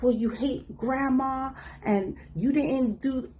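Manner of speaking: high, slow mom accusation; mock mom accusing.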